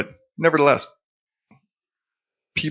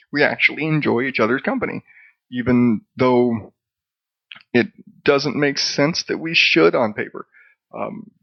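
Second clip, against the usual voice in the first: ordinary talk, nothing marked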